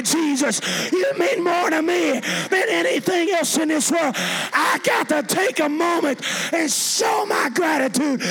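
high, loud, drawn-out shouted devotion; impassioned preacher's shout; preaching at full force. Real, not acted. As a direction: cry it out slowly to the whole church.

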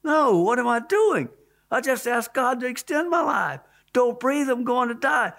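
high, panicked cry of protest; retold desperate scream. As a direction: plead in alarm, pitch held high. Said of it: "I screamed as loud as I could".

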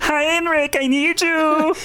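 high, pleading mock German accent. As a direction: call out needily as cartoon German dictator. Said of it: "that's a German accent".

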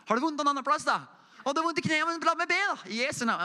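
eager offer to pray. Retold or imitated. Preacher acts out praying for a stranger in a shop, high mock-eager. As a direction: pitch way up, cheerfully pushing on to pray.

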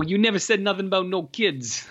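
lightly voiced Giovanni balking at the kid; mock Giovanni. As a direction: play the shocked suitor for laughs. Voicing Giovanni's horrified face.

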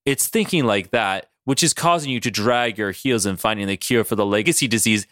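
low, even villain lecture; Mister Sinister. Acted. Sinister lectures Beast on his thinking, low and composed. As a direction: scold in a deep, composed voice. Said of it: "And Sinister is like".

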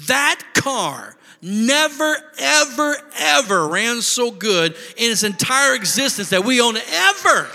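high, loud and stretched out; preacher thrilled, telling the car ran perfectly; excited. Real, not acted. Excited storytelling climax.

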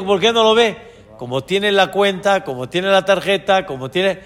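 ordinary delivery

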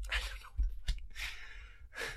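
slow, very soft whisper; whispered aside. Real, not acted. Muttered aside after game analogy.

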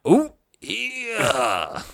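dumb wrestler catchphrase voice; drawn-out "ooh", then a long growly "yeah"; Macho Man. Acted. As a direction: do the silly wrestler voice. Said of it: "people do the dumb voice".

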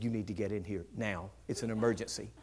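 low, soft mock urgency; hushed urgency. Bridesmaid to pastor at the barely opened door, hushed urgency. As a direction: imitate a hushed, urgent summons.

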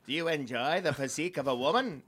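lightly voiced drunk uncle come-on; a hint of drunk-uncle delivery, mock pickup line. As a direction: swoop through it like a tipsy uncle at a bar. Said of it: "It's like a drunk uncle or something".

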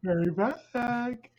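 put-on slow, airy ghost girl farewell; drawn out, high and eerie; Little Leota voice. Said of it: "Speaking of Little Leota".

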